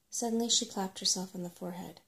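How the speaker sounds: usual speaking voice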